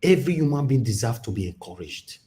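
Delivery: usual speaking voice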